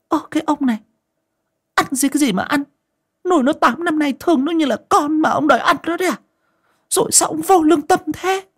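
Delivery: high-pitched nagging wife; old wife nags her husband, high and quick; scolding wife. Acted. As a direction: nag him in a high voice.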